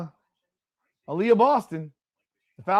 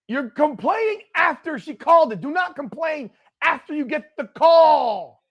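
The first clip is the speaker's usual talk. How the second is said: higher and louder, scolding hard; angry rant; 9 semitones higher, 5 dB louder than usual. Real, not acted. Fan yelling at Caitlin for complaining after the call, angry. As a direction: yell at the screen, fed up.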